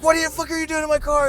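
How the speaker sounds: high, fast mock yell; incredulous yell. Pete demanding why a stranger is in his car, high fast yell. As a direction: fire it off in disbelief.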